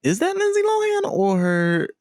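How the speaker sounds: high, quick double take; surprised question. Retold or imitated. Fan watching the video, high surprised double take. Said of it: "who was like".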